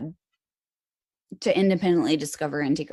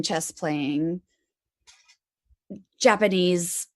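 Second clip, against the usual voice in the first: ordinary delivery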